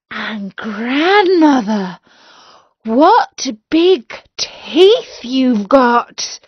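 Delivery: put-on a girl growing scared of the teeth; a hint of alarm, drawn out slowly; frightened girl.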